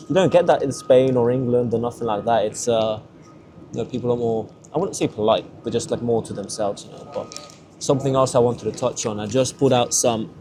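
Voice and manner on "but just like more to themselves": ordinary talk, nothing marked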